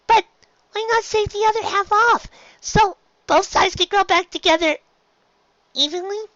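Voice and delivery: small filly, puzzled and hesitant; curious filly. Acted. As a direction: ask shyly like a young girl. Filly suggests.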